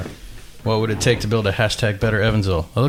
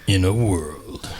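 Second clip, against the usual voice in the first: slow epic trailer narrator; movie trailer voice; much slower than usual. Acted. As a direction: announce it like a blockbuster trailer. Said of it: "It's the movie trailer voice guy".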